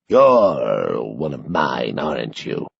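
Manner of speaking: put-on low, very slow frail old man, fading away; old Sanderson. A narrator's reading. Old man asking agent Walker, low and fading. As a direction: wheeze it out knowingly. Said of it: "Sanderson tilts his head".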